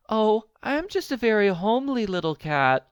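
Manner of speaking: meek and modest; little kitten. A narrator's reading. Frightened kitten answering the old man, small meek voice. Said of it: "said the kitten".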